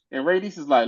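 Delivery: usual speaking voice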